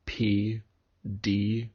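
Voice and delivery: ordinary talk, nothing marked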